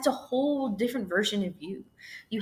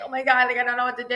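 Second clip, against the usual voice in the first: higher, louder mock panic; a raised, flustered retelling of panic; imitated freak-out; 5 semitones higher, 3 dB louder, much faster than usual. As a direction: act out someone panicking. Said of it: "they're freaking out and they're like".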